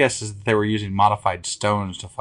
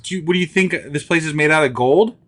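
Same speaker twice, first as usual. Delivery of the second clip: incredulous scolding; 7 semitones higher, 4 dB louder than usual. Acted. King scolding servant.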